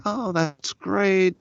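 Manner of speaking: high, slow drawn-out delight; pleased reply. Retold or imitated. Reacting to Tony's trip news, high and slow. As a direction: stretch it out, happy for him. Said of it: "And I was like".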